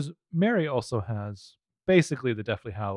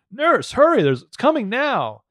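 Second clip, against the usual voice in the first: raised, high-pitched mock alarm; urgent calling out; 9 semitones higher, 5 dB louder, slower than usual. Male nurse calling from the birthing room, urgent call. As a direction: call down the hall for help, hammed up. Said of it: "a male nurse calls from the birthing room".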